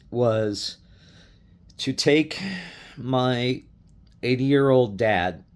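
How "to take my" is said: normal talking voice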